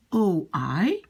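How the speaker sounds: ordinary delivery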